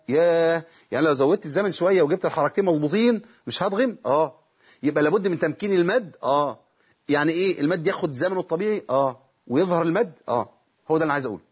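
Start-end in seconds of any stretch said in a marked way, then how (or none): none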